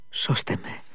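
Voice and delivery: breathless whispered plea; sudden whisper. A narrator's reading. Liza suddenly begs Alyosha to save her, whispering. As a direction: blurt a soft, desperate whisper. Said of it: "είπε ξάφνου".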